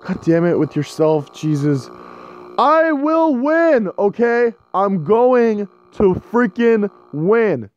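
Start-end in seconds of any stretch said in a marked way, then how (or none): 2.6-7.7 determined vow. Real, not acted. Player vowing to beat the night, slow determined shout. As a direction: drag each word out like a war cry.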